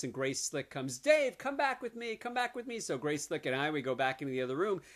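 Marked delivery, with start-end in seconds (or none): 1.0-2.8 much higher mock seductive call; lightly campy woman's voice beckoning. [name] luring the comic away.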